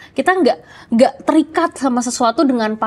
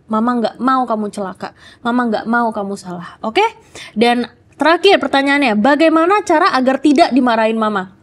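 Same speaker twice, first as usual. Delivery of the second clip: ordinary talk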